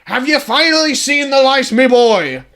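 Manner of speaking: the father bellowing at his son; booming old clan leader; gruff father Ronald. Acted. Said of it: "Ronald sees him".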